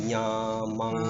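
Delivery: यमंगणनम drawn out on one nasal note; sustained, nasalised chant of यमंगणनम; into the nose. Shown as a demonstration. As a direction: let यमंगणनम hum through the nose. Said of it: "थोड़ा नाक में भी आना चाहिए".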